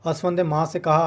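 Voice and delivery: ordinary delivery, nothing marked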